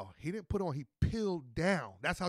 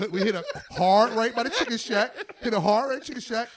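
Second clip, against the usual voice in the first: higher, louder laughing retelling; laughing excitement; excited laughing; 5 semitones higher, 6 dB louder than usual. Real, not acted. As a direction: relive the escape gleefully. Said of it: "excited retelling with laughter".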